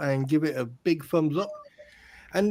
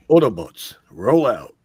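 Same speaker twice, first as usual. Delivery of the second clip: deep, loud commanding voice; heroic robot leader; 7 semitones lower, 8 dB louder than usual. Pretend. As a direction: give a booming, low rallying order. Quotes a robot hero's battle command.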